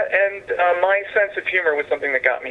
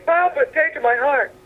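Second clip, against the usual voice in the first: pleading crowd; 13 semitones higher than usual. Retold or imitated. Crowd voiced in a joke.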